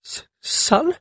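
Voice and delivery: high, stammered question; faltering. A narrator's reading. Mother recognising her long-lost son, faltering. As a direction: stumble on the first sound in disbelief. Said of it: "she faltered".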